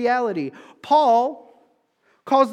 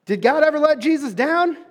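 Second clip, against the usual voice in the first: higher and louder, calling to the crowd; preaching loudly; 6 semitones higher, 5 dB louder than usual. Shown as a demonstration. Preacher pushes the congregation to answer, loud and high. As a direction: throw the question out with full force. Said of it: "I'm preaching my heart out up here".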